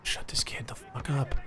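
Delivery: hissed under the breath; annoyed whisper. Real, not acted. Annoyed by kid on mic.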